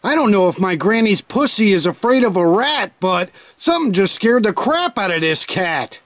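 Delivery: usual speaking voice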